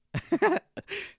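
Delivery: shrill burst of laughter; high laugh. Real, not acted. Storyteller laughing at his own trouble, high laugh.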